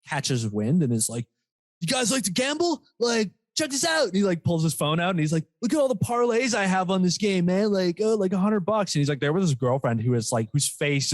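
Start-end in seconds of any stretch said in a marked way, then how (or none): 1.8-4.0 high-pitched bragging bro; imitating eager gambler. Stranger showing friends his bets, mock eager. 5.6-8.9 high-pitched bragging bro; imitating eager gambler. Stranger showing friends his bets, mock eager.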